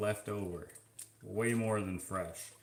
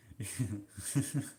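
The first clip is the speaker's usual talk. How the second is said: laugh; 3 semitones lower than usual. Real, not acted. Stuck for something to say.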